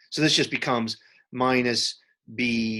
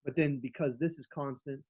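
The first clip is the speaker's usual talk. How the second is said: muffled mic; 4 semitones higher, 4 dB quieter than usual. Real, not acted. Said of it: "Sean, it happened again. The mic".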